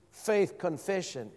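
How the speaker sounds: usual speaking voice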